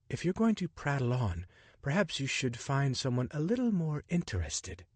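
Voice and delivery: put-on a clipped, dismissive English accent; cool English lady brushing off a chatterbox; thick English accent. English woman on the train dismissing Richard, in a thick English accent. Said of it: "she said in a thick English accent".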